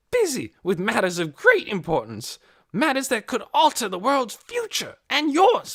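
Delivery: loud, high indignant ranting; railing. A narrator's reading. Hoyt railing at Missus Beeson before the officers, indignant. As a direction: rant in rising indignation. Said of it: "Hoyt railed on".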